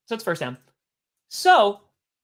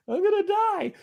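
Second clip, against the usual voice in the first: high-pitched squeal of comic dread; mock panic; 13 semitones higher than usual. Storyteller reliving fear in the cellar, mock panic.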